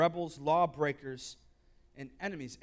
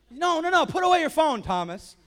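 louder and higher, playful no no no; mock scolding; 10 semitones higher, 9 dB louder than usual. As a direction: tell him off with a grin. Jesus scolding Thomas playfully.

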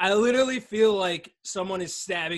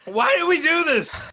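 much higher, pained and louder; mouth on fire; 12 semitones higher, 5 dB louder, slower than usual. Real, not acted. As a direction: cry out from the burning heat. Eating super hot chip.

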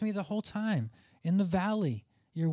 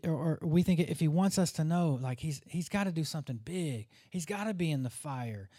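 ordinary delivery

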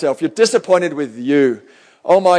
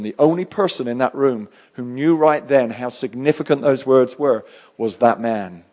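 ordinary talk, nothing marked

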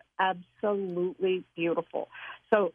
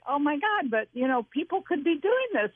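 higher, thrilled gasp of realization; excited realization; 8 semitones higher than usual. Retold or imitated. Retelling her own thrill at the doctor's reaction, higher and excited. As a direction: lift up with amazement at the discovery. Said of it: "it was like".

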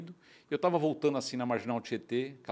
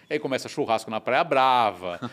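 normal talking voice

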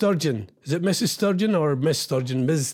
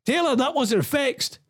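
playful protesting; 8 semitones higher, slower than usual. Pretend. Pleads with losing player.

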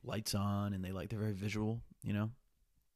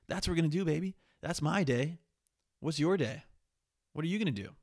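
upbeat, higher and louder hype; excited; 7 semitones higher, 6 dB louder than usual. Real, not acted. Hyping listeners up about his day, higher and louder. Cheery daily plan to listeners.

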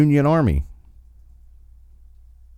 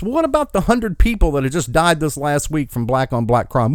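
usual speaking voice

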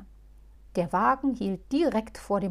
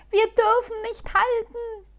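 high, loud anxious wail; whining; 14 semitones higher, 8 dB louder than usual. Acted. Halbschuh whining to Muff at the crossing. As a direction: wail it again in despair. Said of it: "jammerte Halbschuh aufs Neue".

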